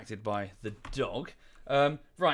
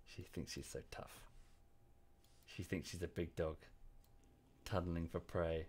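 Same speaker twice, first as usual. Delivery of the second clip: much softer, hushed teasing about the dog; quiet fond commentary on the pet; soft murmur; 5 semitones lower, 10 dB quieter than usual. Real, not acted. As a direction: murmur fondly under your breath. Murmuring about dog digging nearby.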